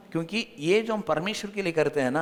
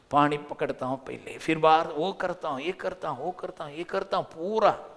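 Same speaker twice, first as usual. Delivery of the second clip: ordinary talk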